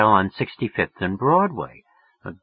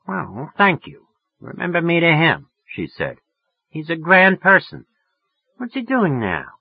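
much higher woman's voice turning sweet; suddenly friendly; 8 semitones higher than usual. Acted. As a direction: warm up to the caller. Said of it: "She was getting friendly as hell".

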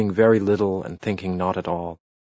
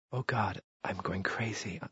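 overcome murmur; 10 dB quieter than usual. A narrator's reading. Lover overcome, murmuring in bed.

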